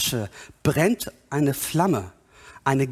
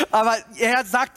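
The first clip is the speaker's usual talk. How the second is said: usual speaking voice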